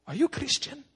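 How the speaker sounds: high-pitched imitation of a Chinese pastor's accent; incredulous pastor, put-on Chinese accent. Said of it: "I look at the Chinese pastor".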